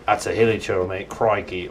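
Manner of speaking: put-on a light Australian accent on the trail talk. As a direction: play a cheery Aussie ranger, lightly voiced. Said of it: "only if they had, like, an Australian accent".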